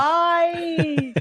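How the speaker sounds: high, drawn-out mock despair; mock wailing. Co-host moaning about endlessly discussing Westworld, mock wailing. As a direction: wail it out like a hammed-up lament.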